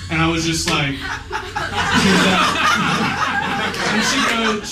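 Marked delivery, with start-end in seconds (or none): 1.0-2.8 squealing high laugh. Real, not acted. Retelling the girl's confession, high laughter. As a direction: burst out laughing.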